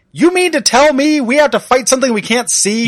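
high, loud indignant shout; hammed-up disbelieving yell; mock outraged yell. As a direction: belt it like a bratty hero. Cyclops's outburst at his wife, retold.

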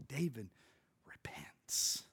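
a single whispered word; hushed whisper. Real, not acted. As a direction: whisper it, heavy with meaning. Climax of David's story.

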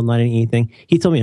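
usual speaking voice

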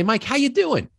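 high light imitation of the mentor; mentor's greeting. Mentor in a good mood greeting Mike, high and light. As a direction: lift the voice way up for the good-mood boss. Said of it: "he'd say".

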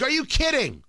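stunned, can't believe it; incredulous. Retold or imitated. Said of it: "I'm like".

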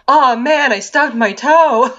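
high, drawn-out mock lament; mock whiny complaint. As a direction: make a big deal of a small mishap. Mimics casual complaint after biting head off.

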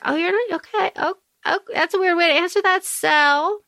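taken aback. Acted. Acting a taken-aback reply to Sel.

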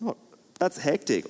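ordinary delivery

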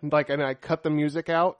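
usual speaking voice

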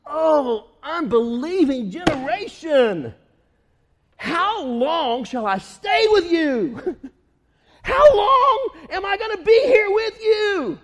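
slow, loud, exasperated cry building to a laughing shout; fed up, drawn out and then bursting; exasperated Jesus. Retold or imitated. Jesus fed up with the crowd, building shout. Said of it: "he says".

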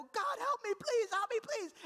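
very high, loud mock begging; hammed-up frantic prayer; desperate plea. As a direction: beg heaven for help in a panic. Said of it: "And I go".

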